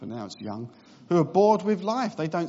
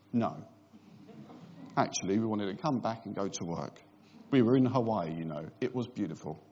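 bored newlywed; 8 semitones lower, 5 dB quieter than usual. Retold or imitated. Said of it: "where they're just bored already".

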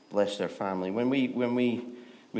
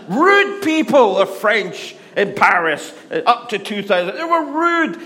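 very loud, high, comic outburst; emphatic shouting; 12 semitones higher, 14 dB louder than usual. Real, not acted. Preacher declaring to audience that Parisians were rude, shouting. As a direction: belt it out with relish.

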